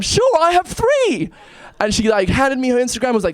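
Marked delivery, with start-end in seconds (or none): 0.0-1.3 slow, much higher put-on English girl's accent; a cheery English girl at a bar, slow and high. Girl at a bar offering her Instagrams in a high English accent. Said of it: "I'm gonna try an English accent".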